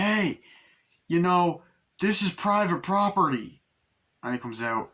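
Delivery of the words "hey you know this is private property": higher, put-on voice warning strangers off; a dad guarding his driveway, voiced high and odd; mock threatening homeowner. Father warning the family outside, in a higher put-on voice.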